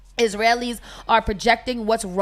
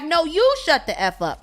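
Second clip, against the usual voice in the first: retold yell back; 6 semitones higher than usual. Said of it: "went nuts and yelled back".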